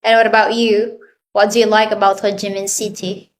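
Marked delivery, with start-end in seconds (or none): none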